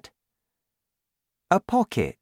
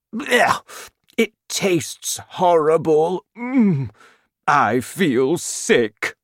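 loud retching disgust, pitched up; disgusted cry; 7 semitones higher, 4 dB louder, slower than usual. A narrator's reading. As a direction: groan as if about to be sick. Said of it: "he cried".

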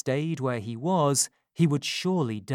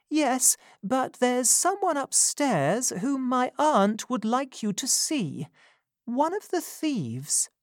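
put-on higher, gentle young lady's voice; a kind young woman taking charge; young woman Rose; 10 semitones higher than usual. Rose hushing Giles and ordering care, higher gentle voice.

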